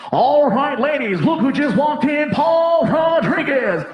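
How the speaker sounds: hype-man DJ intro; DJ announcement. Retold or imitated. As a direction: announce it like a strip-club DJ.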